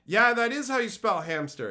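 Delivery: high, loud defensive protest; mock upset. Employee insists on spelling to boss, mock upset. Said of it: "starts crying and gets upset".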